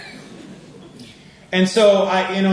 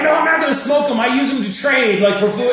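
higher, louder mock protest; protesting retort; 8 semitones higher, 5 dB louder than usual. Defending the cigarettes to the minister, protesting. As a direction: blurt it out as an indignant defense. Acting out man's indignant reply.

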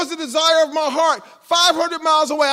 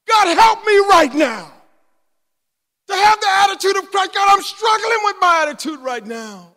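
raised, high-pitched plea; impassioned preacher's cry; crying out to God; 6 semitones higher, 4 dB louder than usual. Acted. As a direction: beg for help in loud prayer. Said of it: "you cried out to God".

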